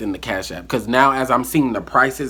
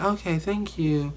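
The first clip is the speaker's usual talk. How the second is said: higher, slow, lightly mimicked thank-you; imitating the friend; 7 semitones higher, 5 dB quieter, slower than usual. Mocking the friend who never pays back, slow and higher. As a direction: mimic her casual thanks, drawn out.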